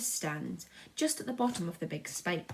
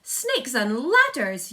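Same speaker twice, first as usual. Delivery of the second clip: very loud, slow exclamation; yell; 5 semitones higher, 11 dB louder, much slower than usual. A narrator's reading. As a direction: bellow it in horror. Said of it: "yelled the head of the fire department".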